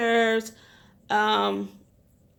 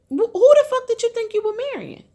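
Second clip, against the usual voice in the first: much higher and louder in disbelief; incredulous outburst; 12 semitones higher, 5 dB louder than usual. Real, not acted. Vlogger rants at the wife, incredulous and loud. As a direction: blow up at her in disbelief. Ranting at Larissa.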